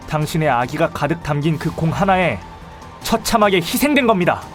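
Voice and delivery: high, loud, building to a climax; impassioned accusation. Acted. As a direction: hurl the final accusation at the culprit. (격앙되어 높고 크게 규탄하듯)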